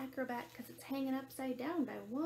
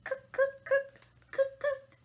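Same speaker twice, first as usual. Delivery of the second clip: high, loud staccato bird call; imitating a woodpecker in sharp bursts; 11 semitones higher, 12 dB louder, slower than usual. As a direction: rap out short woodpecker cries. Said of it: "Woodpecker calls from a tree".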